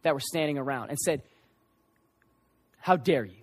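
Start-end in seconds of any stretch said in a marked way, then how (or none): 2.8-3.4 low, stern reproach; deep-set, understated indignation; retold rebuke. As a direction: imitate a controlled scolding, pitched down. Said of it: "it was a rebuke to the people".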